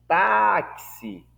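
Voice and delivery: táxi with TA high and drawn out; stress on penultimate syllable. Shown as a demonstration. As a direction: punch and stretch the TA of táxi. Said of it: "na penúltima sílaba".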